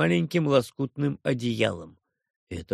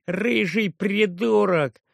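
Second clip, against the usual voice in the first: mock yell; 6 semitones higher, 3 dB louder, slower than usual. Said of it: "Стивен вопящий".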